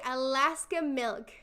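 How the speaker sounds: usual speaking voice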